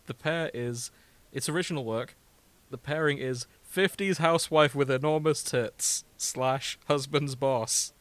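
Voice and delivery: ordinary talk, nothing marked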